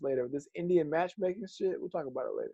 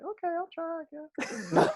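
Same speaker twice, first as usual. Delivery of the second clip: much higher, mock half-hearted reply; retelling his reply to the recruiter, mock half-hearted and high; mock reluctant self; slower than usual. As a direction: play your younger self shrugging it off up high. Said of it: "I was like".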